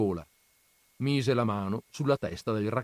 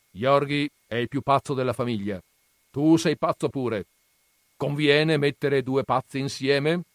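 lightly voiced scornful old grandfather, raised; gruff grandfather; 5 semitones higher, 6 dB louder than usual. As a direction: scoff at the books' dreamers. Grandfather weighs. (nonno burbero accennato, tono sprezzante)

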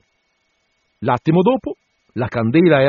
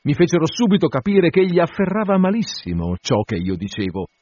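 usual speaking voice

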